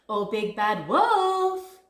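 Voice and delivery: loud, slow drawn-out call; crying out. A narrator's reading. Missus Duck opening door to Big Bad Wolf, calling out. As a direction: call after someone leaving. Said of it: "cried Missus Duck".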